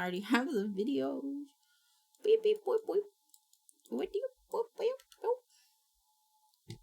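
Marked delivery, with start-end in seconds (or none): 2.2-6.8 high robot beep boop noises. Pretend. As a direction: squeak it like a little robot.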